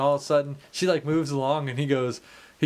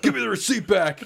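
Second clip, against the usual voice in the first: high, louder, laughing rough demand; blunt drunk demand; 10 semitones higher, 4 dB louder than usual. Retold or imitated. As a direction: bark it out drunk and rude. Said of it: "But it comes out".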